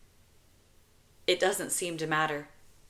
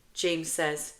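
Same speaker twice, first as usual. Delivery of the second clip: ordinary delivery, nothing marked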